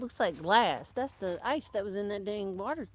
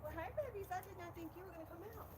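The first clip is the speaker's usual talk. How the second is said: soothing a scared dog; 9 semitones higher than usual. Real, not acted. Cooing to animals at dawn.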